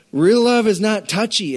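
slow, high, loud Real love; preaching with weight; stress on love. Acted. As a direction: hammer the point home. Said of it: "preaching emphasis".

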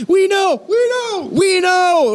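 high, shouted mock church crowd; excited crowd. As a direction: cheer it like a thrilled congregation. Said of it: "And they're all excited".

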